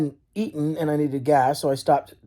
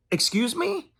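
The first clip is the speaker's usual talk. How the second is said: incredulous; 10 semitones higher, 5 dB quieter, slower than usual. Retold or imitated. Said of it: "And I was like".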